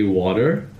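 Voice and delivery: "water" drawn out with an American r; American-style "water", slowly. Shown as a demonstration. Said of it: "the American style".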